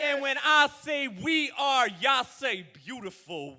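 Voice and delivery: loud, high chant call; call and response shout. Real, not acted. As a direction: call it out to the crowd.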